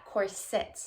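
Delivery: "corset" as COR-sit; correcting "corset" to COR-sit; stress on first syllable. Retold or imitated. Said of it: "Putting the emphasis on the first syllable".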